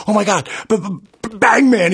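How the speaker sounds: imitating a shaking, starstruck cop; high-pitched, stuttering awe; starstruck stammer. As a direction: stammer it out like an overwhelmed fan. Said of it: "and he's shaking. He goes".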